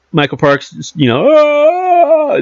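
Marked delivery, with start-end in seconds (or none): 1.3-2.4 long, high held mock scream; high, drawn-out "ah" imitating the villain; creepy imitated scream. Reviewer imitating the actor's creepy moment, mock scream. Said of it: "that was creepy too".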